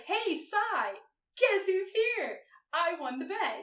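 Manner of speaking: high-pitched, teasing announcement; gloating call. Retold or imitated. The prospect tells Cy he won the bet, gloating. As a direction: call it out like a gleeful bet winner.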